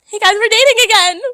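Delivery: high, loud announcement to a party; gleeful mock-drunk holler; excited yell. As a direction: shout the good news to everyone. Yelling news to the party.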